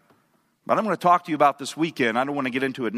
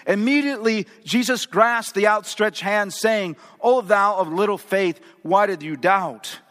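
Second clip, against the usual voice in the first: dramatic devotional reading; 5 semitones higher than usual. A narrator's reading. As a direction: build the storm story up to the rescue. Reads a book passage aloud.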